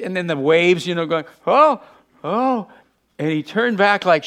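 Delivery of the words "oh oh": mock alarm. Acts reaction to the waves.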